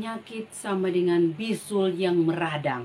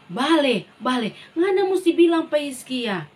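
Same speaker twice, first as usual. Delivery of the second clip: commanding call; 5 semitones higher, 6 dB louder than usual. Acted. God commands the prophet.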